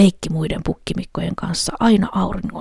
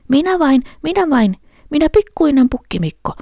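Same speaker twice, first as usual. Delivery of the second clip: high, louder tiny goat voice; little goat; 10 semitones higher, 4 dB louder than usual. A narrator's reading. Little goat answering the troll, high and small. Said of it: "sanoi pukki".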